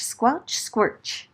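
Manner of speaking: plain reading voice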